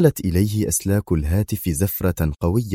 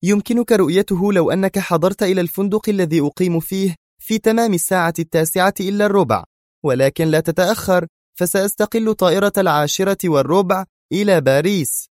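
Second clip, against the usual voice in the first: hurried answer; 12 semitones higher than usual. A narrator's reading. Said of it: "أسرعت تجيب".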